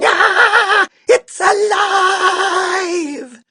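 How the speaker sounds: high, full-blast shriek with stretched vowels; panicked long-drawn screaming; terrified scream. A narrator's reading. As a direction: scream in horror, dragging out every word. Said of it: "The lady screamed".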